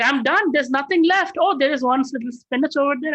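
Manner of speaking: high, pleading woman's voice; pleading Draupadi. Retold or imitated. Draupadi pleads with Krishna that nothing is left, high and pleading. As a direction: beg with nothing left to give.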